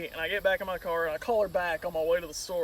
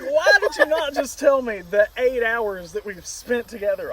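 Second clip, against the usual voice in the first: raised, insistent indignant question; retold outrage; 4 semitones higher, 5 dB louder than usual. Repeating his demand to the girlfriend, loud indignation. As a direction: press the point loudly, acting fed up.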